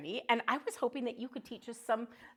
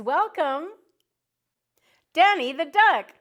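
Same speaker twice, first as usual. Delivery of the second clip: 1 grand welcome; 7 semitones higher, 11 dB louder than usual. Real, not acted. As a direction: draw it out, then a big loud cheer on the name. Introducing the letter guest to kids.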